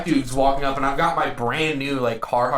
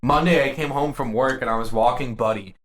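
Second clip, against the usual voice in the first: usual speaking voice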